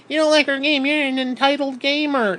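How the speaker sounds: high mock whine of the game developers. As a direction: sneer it like a smug developer. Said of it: "All these fucking developers".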